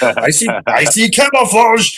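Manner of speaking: high squeal over camouflage; excited camouflage find. Retold or imitated. Driver spotting camouflage at a yard sale, high squeal. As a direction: gush like a kid spotting treasure. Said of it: "I'm, like, creeping".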